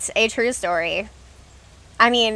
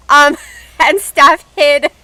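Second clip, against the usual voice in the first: slow, louder, broken up by real laughter; laughing; 3 semitones higher, 8 dB louder, slower than usual. Real, not acted. Streamer telling viewers which moderator hid a comment, laughing. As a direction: get the words out while laughing. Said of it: "I can't stop laughing".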